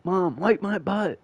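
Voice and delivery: put-on high, slow whiny little boy; whiny kid. Kid whining at his mom to wipe him. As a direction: whine it like a spoiled five-year-old. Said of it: "He's probably like".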